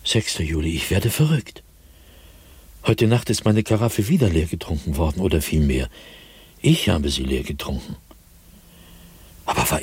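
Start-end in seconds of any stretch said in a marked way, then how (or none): none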